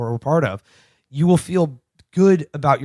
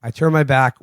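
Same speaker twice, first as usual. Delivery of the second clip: ordinary delivery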